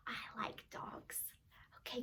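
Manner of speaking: soft unvoiced whisper. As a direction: share it like a secret. Whispering to toddler.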